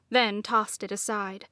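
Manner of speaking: ordinary delivery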